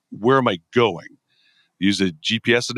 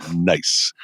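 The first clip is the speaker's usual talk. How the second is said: South Park; 7 semitones lower than usual. Retold or imitated. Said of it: "that would sound like a South Park".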